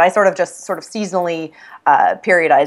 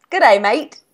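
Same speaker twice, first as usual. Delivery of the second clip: a quick mock Australian "Good day, mate"; 6 semitones higher than usual. Friend teasing on the phone, mock Australian accent. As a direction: toss off a cheesy Australian greeting. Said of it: "try your accent".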